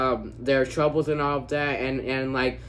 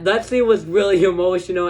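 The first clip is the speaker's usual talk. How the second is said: ordinary talk, nothing marked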